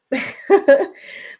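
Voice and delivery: short, high-pitched laugh; amused laugh. Real, not acted. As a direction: laugh at your own joke. Amused at own stalking joke.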